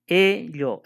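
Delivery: Ello slowly, first E held long; stress on E. Shown as a demonstration. Teacher showing where Ello is stressed, long held E. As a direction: stretch the E of Ello, then a separate llo. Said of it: "es la E".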